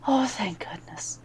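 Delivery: whispered thanks on a sigh of relief; relieved whisper. Acted. Mother Superior whispers relief, hands clasped over her heart.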